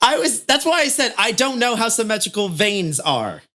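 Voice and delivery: high, loud, exasperated comeback; worked-up self-defence; defensive protest. Real, not acted. Defensive back-and-forth about veins.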